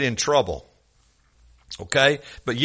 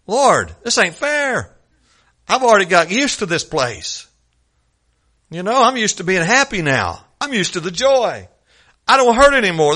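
pleading protest; 5 semitones higher than usual. Acted. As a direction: whine to the Lord like you don't want to leave. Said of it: "that's how I'd feel".

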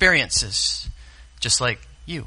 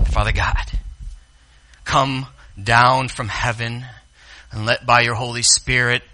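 preacher praying for the church; pastoral prayer; 4 dB louder than usual. Acted. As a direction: pray it over the congregation. Leads a prayer.

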